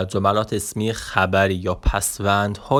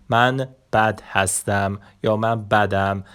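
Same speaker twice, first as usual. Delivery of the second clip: ordinary talk, nothing marked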